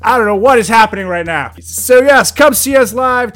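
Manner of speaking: loud, pushy promo voice. Acted. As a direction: push the show, loud and punchy. Ribbing friend, promoting.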